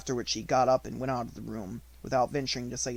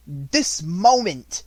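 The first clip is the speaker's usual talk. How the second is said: very slow, louder fierce order; 4 semitones higher, 8 dB louder, much slower than usual. A narrator's reading. Headmistress snapping at her dithering sister, slow and fierce. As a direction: bite off each word. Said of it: "was the fierce answer".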